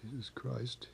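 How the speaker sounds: hushed, barely voiced; a faint, frightened stammerer's utterance; real soft. A narrator's reading. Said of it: "It was real soft".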